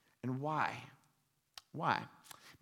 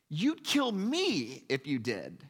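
slow, slightly raised pleading; ghost man pleading; 3 semitones higher, 4 dB louder, slower than usual. Retold or imitated. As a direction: drag it out in protest. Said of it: "and the man says".

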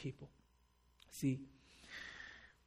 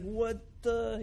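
higher-pitched mock disbelief; a fan gasping at an interception; 8 semitones higher than usual. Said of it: "It's like".

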